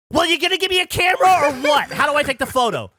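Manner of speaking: high, loud irritated demand; mad lady. Retold or imitated. Lady asking the proposer for a camera, mad. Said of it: "this lady's mad".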